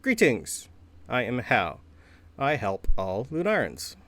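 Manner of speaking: stiff, stilted construct voice; halting robot greeting, pitch lifting on the last question. Acted. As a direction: recite it like a programmed helper bot. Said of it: "It's more stiff".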